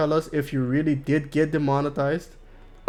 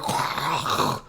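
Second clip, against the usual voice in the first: long throaty rasped "kh" noise; guttural Dutch G; 6 dB quieter than usual. Retold or imitated. Streamer imitating a Dutch guy's G, throat-clearing rasp. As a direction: scrape out an exaggerated Dutch G. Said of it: "So he's constantly like".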